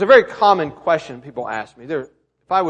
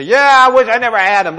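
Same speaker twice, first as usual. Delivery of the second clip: louder mock-weary parent; sarcastic; 4 semitones higher, 7 dB louder than usual. Parent joking about having kids, sarcastic. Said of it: "in our sarcasm, we may say".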